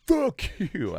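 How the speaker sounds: mock angry retort. Rejected passenger to seatmate, mock angry retort.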